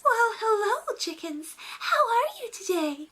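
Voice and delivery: very high, sing-song princess greeting. Retold or imitated. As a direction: coo at the horse like a Disney princess. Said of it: "goes up to the chickens and says".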